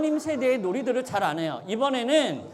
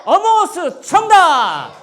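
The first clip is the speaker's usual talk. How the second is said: shout; 8 semitones higher, 10 dB louder, slower than usual. Real, not acted. Host shouting answer, next question.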